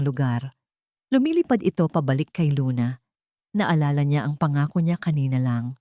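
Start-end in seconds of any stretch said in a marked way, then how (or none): none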